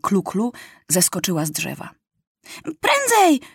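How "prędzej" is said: high urgent shout. A narrator's reading. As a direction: hurry them on with a high cry. (Kluklu krzyczy wysoko, ponaglając)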